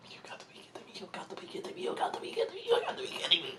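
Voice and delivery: frantic repeated whispering; exasperated whisper. Retold or imitated. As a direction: mutter in disbelief under your breath. Muttering while friend stops everyone.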